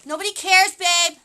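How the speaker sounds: high, loud call to a partner; loud retort. Real, not acted. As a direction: shout it over to your babe. Teasing shout to partner nearby.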